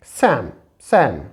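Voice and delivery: सैम said slowly as sam, no l; silent l demo. Shown as a demonstration. Teacher showing students the silent l in सैम. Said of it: "इसे हम पढ़ते हैं".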